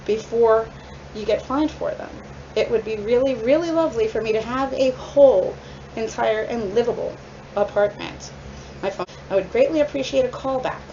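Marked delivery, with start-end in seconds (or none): none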